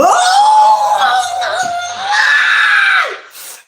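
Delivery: long, very high, loud screaming laugh; shrieking laugh. Real, not acted. Shrieking laugh at voodoo doll.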